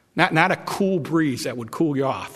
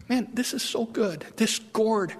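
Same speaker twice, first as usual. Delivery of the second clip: glad Jonah; 6 semitones higher, 3 dB quieter than usual. Acted. Preacher voicing glad Jonah.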